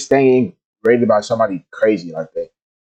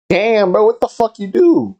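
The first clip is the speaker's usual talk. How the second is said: surprised; 10 semitones higher, slower than usual. Real, not acted. Reacting to forty cancellations.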